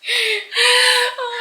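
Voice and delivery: high, loud happy wail; tearful "oh". Real, not acted. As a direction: let out a crying, touched oh. Crying after being asked.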